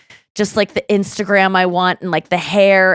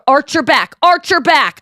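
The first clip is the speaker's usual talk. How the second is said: raised, insistent mock command; yelled commands; 7 semitones higher than usual. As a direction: order it like a director calling commands. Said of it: "yell commands at her".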